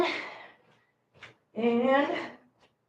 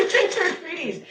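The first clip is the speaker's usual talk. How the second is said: 1 laughing; 5 semitones higher, slower than usual. Real, not acted. Cats grabbing the treats again.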